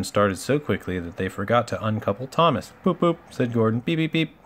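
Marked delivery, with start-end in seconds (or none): 2.8-3.2 high, loud toots; imitated engine hoot. A narrator's reading. Gordon's whistle imitated. 3.8-4.3 high, quick beeps; imitated engine whistle. A narrator's reading. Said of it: "whistled Th".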